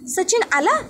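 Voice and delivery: startled question, pitched high with a rising end; surprised. A narrator's reading. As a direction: react as if you can't believe he's here. Mother startled by the news.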